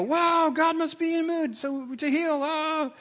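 mock wide-eyed wonder; gushing amazement. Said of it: "They go".

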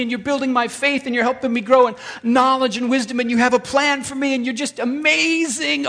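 high, loud, breathless rush of adoration; gushing praise. Acted. Worshiper thanking God, gushing high and loud. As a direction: pour it out like an overjoyed believer.

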